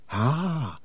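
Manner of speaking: soft, satisfied aside; barely said, pleased; under his breath. Acted. Harry gloats quietly while hiding, soft and under his breath. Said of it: "said Harry under his breath".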